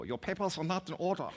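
high-pitched mock German interrogator; Gestapo officer. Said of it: "standing before the Nazi Gestapo".

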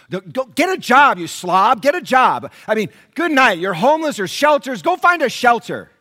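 loud, high mock tirade at the homeless; mock scornful shout. Pastor voicing the congregation's ugly thoughts, mock angry shout. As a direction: bark it like a disgusted passerby.

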